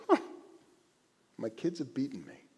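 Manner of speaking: soft, fond chuckling defeat; proud parent, gently conceding; amused God. Acted. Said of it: "God laughs and goes".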